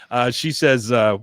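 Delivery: usual speaking voice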